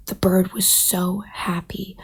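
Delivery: usual speaking voice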